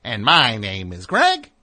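loud, slow singsong leaping up at the end; sing-songy second host. Retold or imitated. Other host announces himself second, hammed-up sing-song. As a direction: parody a co-host's intro, swooping up on the name. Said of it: "has to do some sing-songy second".

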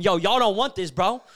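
loud, high tough-guy challenge; calling out a crowd of attackers, daring them to come; yelling threats. Retold or imitated. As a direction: shout them down like you're ready to fight. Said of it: "I start going".

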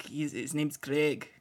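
low, slow try at a Welsh accent; soft, careful Welsh accent attempt. Shown as a demonstration. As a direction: stretch the name in a Welsh accent. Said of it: "that's more Welsh".

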